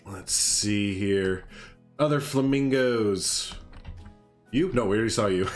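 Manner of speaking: ordinary delivery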